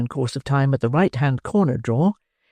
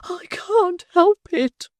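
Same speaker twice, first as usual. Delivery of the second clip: very high, slow whimper; whimpering matron; much slower than usual. Acted. Matron whimpering to the beadle, very high and slow. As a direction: whimper like a fragile lady. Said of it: "whimpered the lady".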